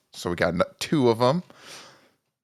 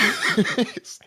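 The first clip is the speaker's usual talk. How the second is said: high laugh; 14 semitones higher than usual. Real, not acted. Laughs at own mishap.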